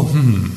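low, drawn-out pondering hmm; thoughtful hmm. Shown as a demonstration. Preacher voices a verse that makes you wonder, low hmm. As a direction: make the puzzled hmm sound. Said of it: "the things that make you go".

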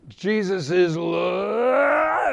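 wrench it out in a long shout; shouted declaration. Retold or imitated. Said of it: "And he goes".

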